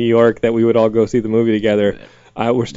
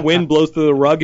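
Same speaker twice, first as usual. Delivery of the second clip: normal talking voice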